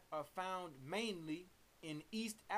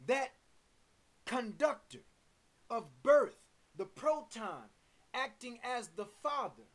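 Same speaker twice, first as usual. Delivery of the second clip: forceful, measured sermon delivery; emphatic preaching; 2 semitones higher, 6 dB louder than usual. Real, not acted. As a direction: proclaim it in weighty, punched phrases. Preaches on God and atom.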